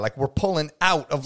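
normal talking voice

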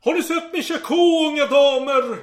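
a lightly hammed desperate soldier; desperate call. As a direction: call up from the wall in mock despair. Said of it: "han ropade förtvivlat".

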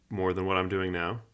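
usual speaking voice

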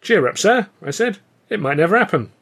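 bright, upbeat and high; jolly him out of his gloom; chirpy young soldier cheering up his captain; cheery. A narrator's reading. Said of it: "I tried to engage him in cheery conversation".

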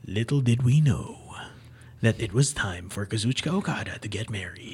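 low, hushed dramatic narrator; anime voiceover. Pretend. Telling co-hosts of a surprise wedding, mock anime voiceover. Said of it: "deliver it in your anime voiceover".

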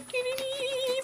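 high little "dee dee dee" imitation; soft, high-pitched tiptoe sing-song; giddy trotting noise. Little newcomer following actors along, high giddy "dee dee". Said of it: "just going".

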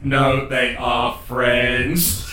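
slow, halting machine voice; robot spelling it out word by word; robot character. Acted. Warforged Shiny vouching for the party, slow robot voice. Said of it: "Shiny then goes".

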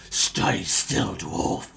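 low hissed threat; spitting through teeth. Acted. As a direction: spit the words at the dwarf. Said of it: "you just see him spitting through his teeth".

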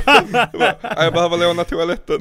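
laughs through the quoted excuse; giggling while retelling the lame excuse; laughing. Retold or imitated.

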